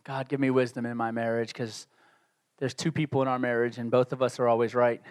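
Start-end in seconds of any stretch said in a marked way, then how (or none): 0.0-1.5 softly, prayerfully; hushed words to God; quiet prayer. Shown as a demonstration. Pastor demonstrating his own prayer, hushed and soft. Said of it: "That's my prayer".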